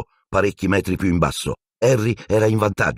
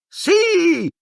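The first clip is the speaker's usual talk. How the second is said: very high, loud squeal; shriek of joy; 3 dB louder than usual. Acted. Ron lifting Harry's arm, very high shriek. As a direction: scream it with glee. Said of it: "strillò Ron".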